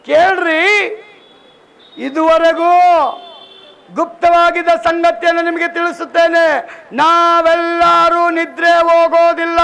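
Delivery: loud, high proclamation, slowing on the last words; thundering the apostle's words to the congregation; preaching scripture. Real, not acted. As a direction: call out the verse like a herald. Repeating the verse loudly.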